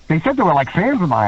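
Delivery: usual speaking voice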